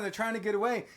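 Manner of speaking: ordinary delivery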